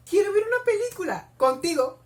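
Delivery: high, loud gushing; high-pitched, swooning outburst; excited squeal. Real, not acted. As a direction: squeal the romantic line with delight. Squealing at a romantic line.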